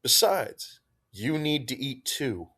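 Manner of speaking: firm insistence. Acted. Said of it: "Narvaez insisted firmly".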